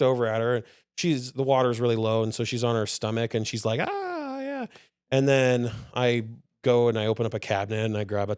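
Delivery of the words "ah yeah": put-on high, cooing baby sound; mock baby contentment; imitating a happy baby. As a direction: coo like a toddler splashing.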